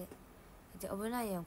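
ordinary delivery

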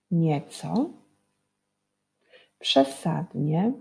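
"nieco, przesadnie" said slowly, word by word with rising tunes; slow teacher emphasis; slow clear reading. Shown as a demonstration. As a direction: draw out "nieco, przesadnie" for the children. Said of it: "podkreślone wyrazy".

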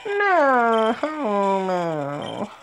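very high, very slow falsetto. Acted. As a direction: stretch it out high and long. Drawn-out falsetto repeat.

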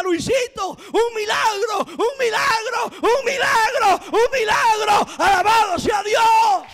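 high, loud shouts of joy, slow and stretched; ecstatic mother. Retold or imitated. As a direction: jump and cry out the miracle.

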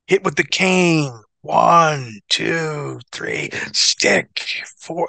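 drawn-out count of wrestling moves; slow, measured step-by-step imitation; calling every detail. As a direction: spell out each step slowly, imitating a match caller.

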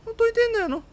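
high baffled whine. Real, not acted. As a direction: whine it, totally lost. Stuck on a puzzle, repeats.

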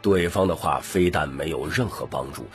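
ordinary delivery

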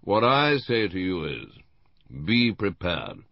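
weighty, finger-wagging advice; admonitory, avuncular. A narrator's reading. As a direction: deliver it like an uncle's solemn warning, pausing before the key phrase. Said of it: "in an admonitory and avuncular manner".

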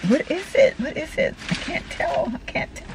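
high, hushed excitement fading to a whisper; breathless curiosity. Real, not acted. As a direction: puzzle over it in a tiny, breathy voice. Peering at a surprise gift.

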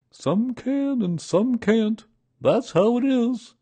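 high, loud bear character; high, measured storybook bear; Pooh's voice. A narrator's reading. Pooh thinking, voiced.

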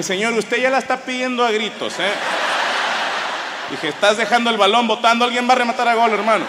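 egging the host on like a football pundit; mock warning. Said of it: "Le dije".

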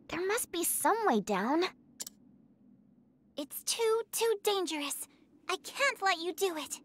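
normal talking voice

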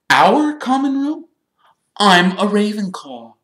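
put-on very high, loud, affronted girl; stiff indignation. A narrator's reading. Ravenclaw girl correcting Ron, stiff and indignant. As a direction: push it up high and loud, offended. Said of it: "said the girl stiffly".

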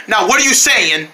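ordinary talk

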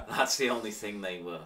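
words through laughter; laughing while talking. Real, not acted. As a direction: keep laughing as you speak. Laughing about tea habit.

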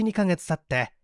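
plain reading voice

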